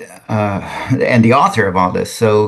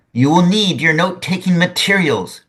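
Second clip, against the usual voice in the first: crying out; 7 semitones higher than usual. A narrator's reading. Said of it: "he cried".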